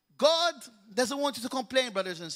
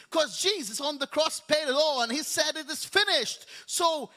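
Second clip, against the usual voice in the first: full-force preaching; 3 semitones higher than usual. Acted. Preacher declaring healing over brothers and sisters, fervent. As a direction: build it up and lift the congregation.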